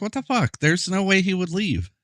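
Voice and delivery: can't believe the coach just left; stunned disbelief. Retold or imitated. Gamer reacting to his coach leaving, in high stunned disbelief.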